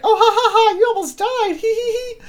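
high giggly voice, laughing through the words; imitating a giggly girl; mock giggling. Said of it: "and she's like".

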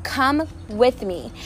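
slow, gentle summons of Jesus; calm Jesus calling. Retold or imitated. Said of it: "says it again and says".

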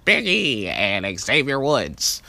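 put-on high, loud old radio announcer; old-timey announcer voice. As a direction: boom it out like a vintage radio host. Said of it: "sounds something like this".

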